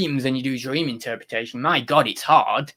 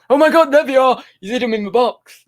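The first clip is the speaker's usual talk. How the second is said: high, loud gleeful gasp; mock excited viewer; 9 semitones higher, 8 dB louder than usual. Mocking chat viewers who say he hid the strips, high and loud. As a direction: ham up a viewer who thinks they caught him. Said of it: "Don't go".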